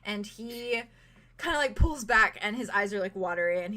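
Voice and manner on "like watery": normal talking voice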